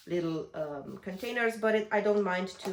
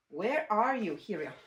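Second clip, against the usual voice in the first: usual speaking voice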